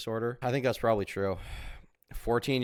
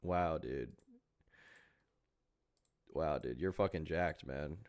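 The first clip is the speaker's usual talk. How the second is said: sarcastic awe; 6 semitones lower, 9 dB quieter, slower than usual. Pretend. Sarcastic awe at gym selfie.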